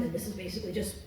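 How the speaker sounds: low, soft, defeated; depressed voice. Shown as a demonstration. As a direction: sigh it out like nothing matters. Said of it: "basically just me but depressed".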